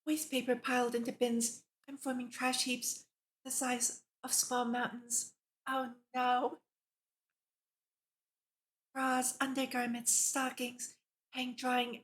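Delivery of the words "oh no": normal talking voice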